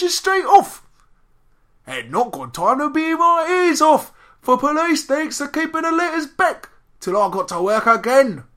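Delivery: high, loud, garbled Cockney caricature; Cockney accent. Acted. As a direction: squawk the letter like an addled Cockney. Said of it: "I like your Cockney accent".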